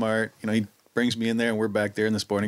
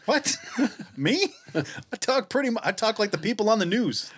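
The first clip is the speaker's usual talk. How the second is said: higher-pitched mock disbelief, laughing into it; mock incredulous; 9 semitones higher than usual. Answering a remark on his funny accent, mock incredulous. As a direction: act taken aback, voice jumping up.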